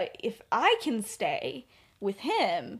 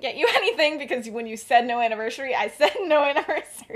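sheepish laughing; 4 semitones higher, 7 dB louder than usual. Acted. Sirius confesses no gift.

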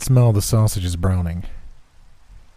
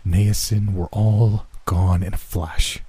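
ordinary delivery

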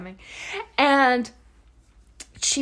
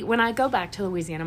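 normal talking voice

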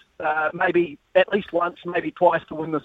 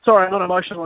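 ordinary talk, nothing marked

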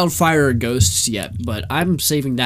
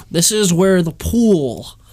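ordinary talk, nothing marked

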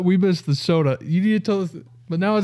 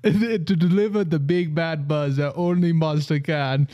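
put-on Jamaican accent. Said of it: "read the whole thing in a Jamaican accent".

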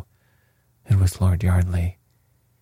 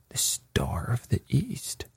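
slow, soft, higher-pitched gasp of astonishment; 4 semitones higher, 7 dB quieter, slower than usual. A narrator's reading. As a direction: gasp it out with hushed amazement. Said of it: "gasped Lord Yardley".

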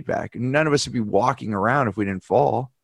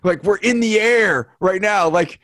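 higher and slower, amazed that they complain; amazed disbelief; 7 semitones higher, 4 dB louder than usual. Real, not acted. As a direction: stretch it out, marvelling at flight. Mocking complainers on a late flight.

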